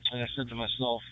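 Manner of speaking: normal talking voice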